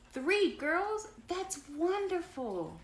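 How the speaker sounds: high, loud storybook excitement; delighted amazement. A narrator's reading. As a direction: gush over wonderful news, slowing at the end. Grace delighted at news, read aloud.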